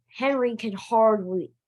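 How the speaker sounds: ordinary delivery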